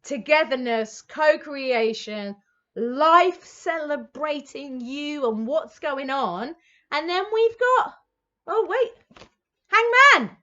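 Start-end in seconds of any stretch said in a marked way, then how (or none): 0.0-6.5 drawn-out, savoured words; slow savouring. Real, not acted. Reader savouring the celebration card for viewers, slow. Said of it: "Let me tell you that". 8.5-10.4 high, loud startled exclamation; surprised call. Real, not acted. Reader surprised by the Hangman card, loud.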